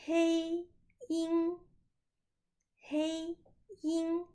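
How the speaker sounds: hei yin held high and level; hei yin both first tone. Shown as a demonstration. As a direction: hei yin, two flat high syllables. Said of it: "Donc ensemble".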